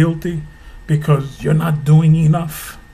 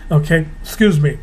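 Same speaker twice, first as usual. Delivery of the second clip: usual speaking voice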